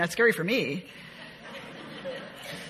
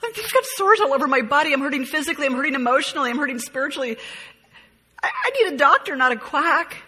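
pained cry, pitched higher and louder; anguished plea; 5 semitones higher, 5 dB louder than usual. Acted. Job crying out to his friends in pained anguish. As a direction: plead like a man in agony.

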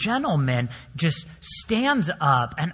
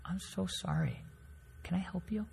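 much softer, gentle and polite; calmly; 3 semitones higher, 15 dB quieter than usual. Retold or imitated. Professor calmly answering the yelling man, much softer. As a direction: answer the yelling quietly, to defuse it. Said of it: "My professor calmly says".

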